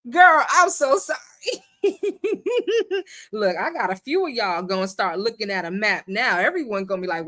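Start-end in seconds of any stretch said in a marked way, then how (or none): 0.1-1.2 high, loud, cracking-up wail; laughing apology. Real, not acted. Host apologising to a teased viewer, helpless with laughter. 1.8-3.0 very high giggling laugh; helpless laugh. Real, not acted. Host cracking up at a viewer's joke, high laughter.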